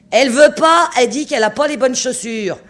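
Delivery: crie vers le bas, voix bien plus haute et forte; crié d'en haut. A narrator's reading. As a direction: porte ta voix jusqu'à la glace. (le chasseur crie la réponse au capitaine)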